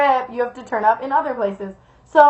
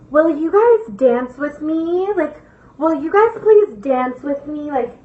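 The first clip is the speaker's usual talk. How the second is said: slow, drawn-out eerie request; imitated weird girl; 3 semitones higher, slower than usual. Weird girl comes up at the bonfire, slow put-on plea. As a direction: stretch every word, imitating a strange girl. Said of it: "doing this, like, dead ass while she's talking".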